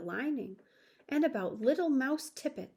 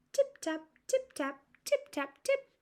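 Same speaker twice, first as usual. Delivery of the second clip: tiny tapping. A narrator's reading. Mice tapping under teacups.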